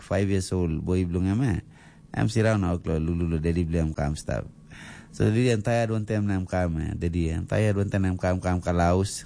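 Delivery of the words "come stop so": ordinary talk, nothing marked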